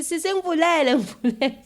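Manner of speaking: high, loud begging to be let in; pleading brother. Retold or imitated. Little brother begs his sister to open the door, pleading.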